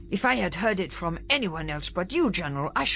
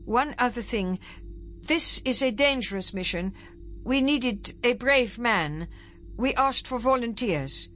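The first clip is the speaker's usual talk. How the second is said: higher-pitched, careful lead-up; hesitant; 8 semitones higher than usual. A narrator's reading. Secretary leading up to hard news for the general, hesitant. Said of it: "hesitated, as if faced with a difficult task".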